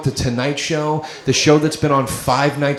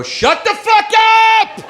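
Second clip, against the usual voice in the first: much higher yelled shut up; shout; 4 dB louder, slower than usual. Retold or imitated. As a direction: yell it at the talkers. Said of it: "I'm the guy that'll go".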